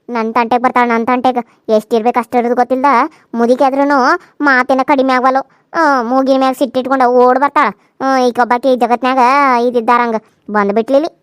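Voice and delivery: low, loud scornful grumbling; angry grumble. Acted. Daughter-in-law grumbling scornfully about her mother-in-law. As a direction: spit scorn at the old woman.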